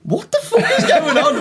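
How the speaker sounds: very high, laughing imitation of baffled disbelief; mock bewilderment. Comic imitating the stunned audience, bewildered and laughing. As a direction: squeal it in mock confusion through laughter.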